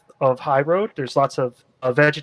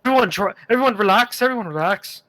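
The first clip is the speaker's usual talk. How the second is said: put-on nervous young bandit; 5 semitones higher, faster than usual. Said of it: "he's like".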